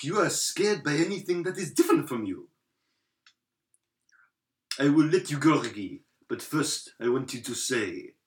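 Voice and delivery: lightly put-on dramatic movie character; mock-dramatic scene delivery; movie scene acting.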